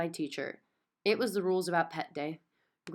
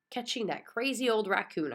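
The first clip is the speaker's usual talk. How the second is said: ordinary delivery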